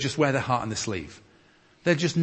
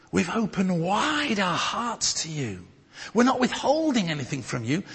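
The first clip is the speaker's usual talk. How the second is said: rising higher and higher, begging; Paul begging the church to open their hearts; pleading; 8 semitones higher than usual. Acted.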